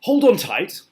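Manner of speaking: high loud grasshopper warning; grasshopper calling out. A narrator's reading. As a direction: yell a warning to everyone aboard.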